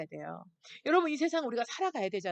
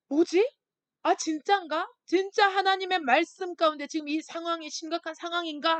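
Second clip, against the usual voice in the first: higher, louder startled questions; alarmed realization; 5 semitones higher, 5 dB louder than usual. Retold or imitated. Congregation member realizing the danger, startled and alarmed. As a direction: wake up to the danger, stunned. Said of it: "하고".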